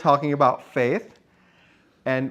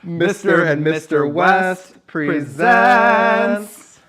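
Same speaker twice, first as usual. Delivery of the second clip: slow announcer voice; 3 semitones higher, 9 dB louder, much slower than usual. Acted. Show title announcement.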